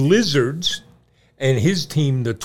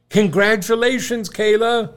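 cheering; 9 semitones higher, 5 dB louder than usual. Real, not acted. Cheering friend's graduation.

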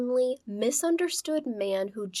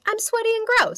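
high, loud mocking voice; mocking the killer; 13 semitones higher, 7 dB louder than usual. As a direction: squeal it like a whiny creep. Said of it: "So he's like".

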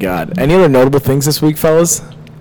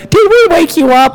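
put-on much higher, sugary little-old-lady voice; sweet old lady; 14 semitones higher than usual. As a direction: imitate the sweet old ladies at the door. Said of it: "And they're like".

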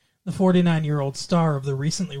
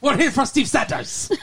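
put-on high, comic gang accent; hammed-up Mexican-ish accent, pitched high; mock mobster accent; 8 semitones higher than usual. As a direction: announce the mob's arrival in a silly accent. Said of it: "I don't know why they have that accent".

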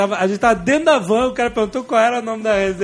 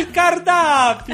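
high, slow mock Italian; hammed-up Italian waiter; fake Italian accent; 6 semitones higher, much slower than usual. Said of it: "ele bota um sotaque". (sotaque italiano caricato)

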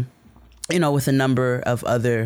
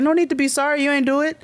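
much higher, eager reassurance; 12 semitones higher, faster than usual. Retold or imitated. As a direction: brush off the apology brightly. Reassuring the tailor.

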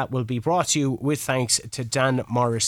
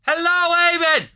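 big high shout from the back of the room; yelling across the room; 6 dB louder than usual. Acted. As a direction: call it out loud from far off. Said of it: "So I'm gonna shout this".

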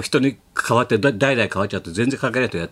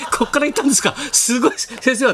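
gushing excitement; 12 semitones higher than usual. Retold or imitated. Junior gushing to his mentor about the old shop, excited. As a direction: mimic the awed junior, pitched well up.

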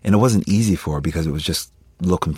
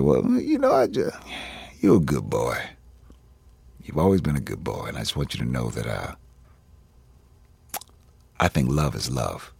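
stepfather's voice; 5 semitones lower, 4 dB quieter than usual. Retold or imitated. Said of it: "I'll do it in his voice".

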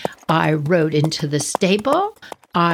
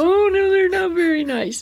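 high, mock tearful whine; crying; 12 semitones higher than usual. Crying child agreeing with her sister, mock tearful. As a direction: whimper agreement, pitched way up. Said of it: "And I'm crying".

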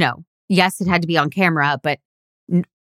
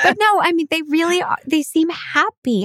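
earnest insistence; 11 semitones higher than usual. Real, not acted. Insisting the couple is happy.

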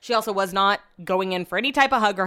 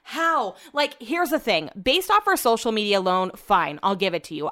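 ordinary delivery

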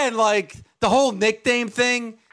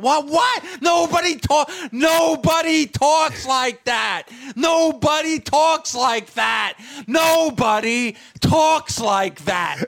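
slow, drawn-out higher-pitched protest; exasperated outburst; 4 semitones higher, slower than usual. Real, not acted. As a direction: hammer each word in disbelief. Exasperated rant at stilted dialogue.

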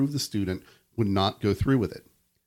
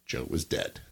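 hushed reveal; 8 semitones lower, 6 dB quieter than usual. A narrator's reading. Dramatic story ending.

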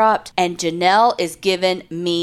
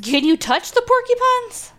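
much higher, slow incredulous question; alarmed disbelief. Real, not acted. Friend hearing of porcupines, high alarmed question. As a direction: squeak it out in disbelief.

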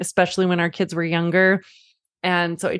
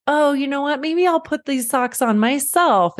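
higher, sing-song pretend cluelessness; playful mock idea; 7 semitones higher than usual. Mother to her daughter about socks, playful clowning. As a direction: tease her by taking the socks.

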